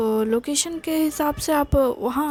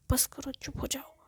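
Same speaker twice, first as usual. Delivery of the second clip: annoyed hush; 12 dB quieter than usual. A narrator's reading. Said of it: "एकदम से परेशान हो जाता है और कहता है".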